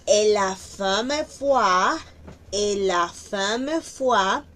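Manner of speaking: "et la femme foi" slowly, linked words; slow liaison demo. Shown as a demonstration. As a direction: glide the words together. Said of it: "Aquí hay una aleación". (enlace lento en "et la femme foi")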